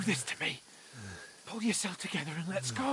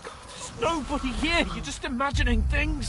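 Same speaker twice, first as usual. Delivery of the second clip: higher, loud and urgent; 8 semitones higher, 5 dB louder than usual. Acted. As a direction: snap him out of panic. Calming a panicking friend.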